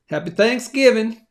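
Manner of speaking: badly faked US Southern twang; terrible Southern accent. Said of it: "doing that in a terrible Southern accent".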